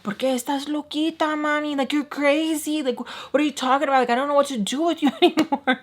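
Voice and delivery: high-pitched imitation of a flustered man; exasperated mock pleading. Man telling her she's crazy, exasperated imitation. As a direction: act out his incredulous protest.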